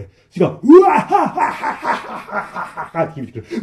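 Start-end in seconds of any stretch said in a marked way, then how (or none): 0.6-3.1 loud, high, booming crowd laugh; huge mocking laughter. A narrator's reading. A hundred voices laugh at the trapped friends, booming. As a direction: roar with evil laughter. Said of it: "大きな笑い声".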